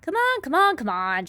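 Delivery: high, loud, impatient yelling; impatient shout. A narrator's reading. Centipede bellows at the glowworm to hurry up, loud impatient. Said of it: "shouted the centipede".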